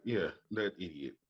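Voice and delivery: ordinary talk, nothing marked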